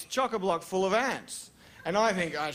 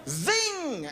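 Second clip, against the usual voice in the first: punchline zing; 9 semitones higher than usual. Acted. Punchline sting.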